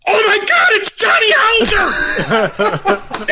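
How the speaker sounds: very high, slow, breaking fan shriek; starstruck squeal. Retold or imitated. Fan spotting Johnny Hauser mid-picture, hammed-up squeal. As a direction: squeal like you just saw your idol. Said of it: "turns away from Gates ... and goes".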